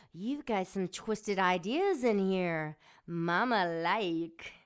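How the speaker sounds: usual speaking voice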